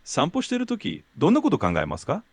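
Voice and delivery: fast fluent Japanese question; native Japanese question. Shown as a demonstration. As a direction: clear native Japanese prompt. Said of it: "Can you repeat that question, please?".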